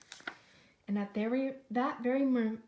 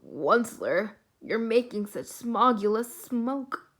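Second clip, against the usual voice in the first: croaking cry; 8 dB louder than usual. Acted. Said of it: "he cried with a crofulous croak".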